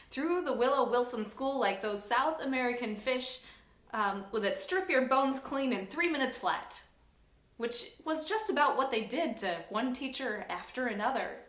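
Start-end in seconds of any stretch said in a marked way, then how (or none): none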